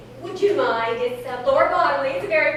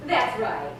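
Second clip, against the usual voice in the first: ordinary talk, nothing marked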